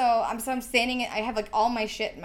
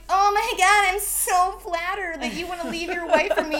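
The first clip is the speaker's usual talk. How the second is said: loud, high-pitched sarcastic delight; hammed-up flattered squeal with a laugh in it; mock gushing; 10 semitones higher, 9 dB louder than usual. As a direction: gush it hugely, obviously fake. Acts out sarcastic imagined gushing reply.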